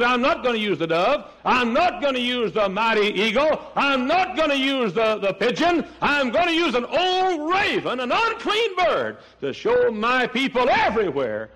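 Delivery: high, proclaiming voice of God, building, with an emphatic not each time; voicing God. Preacher voicing God choosing the raven, high and proclaiming. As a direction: declare it as God choosing the lowly. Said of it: "God said".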